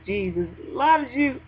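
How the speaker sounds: normal talking voice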